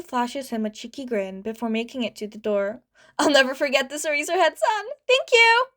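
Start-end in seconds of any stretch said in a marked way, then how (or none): 3.2-5.7 building to a much higher, louder thank you; grateful shout. A narrator's reading. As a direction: call back happily while running. Kid shouts thanks, running off.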